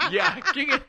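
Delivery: rushing the line through giggles; quoting the joke while cracking up; laughing. Real, not acted. Laughing while repeating the punchline to the clown.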